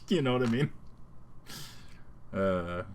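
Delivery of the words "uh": ordinary talk, nothing marked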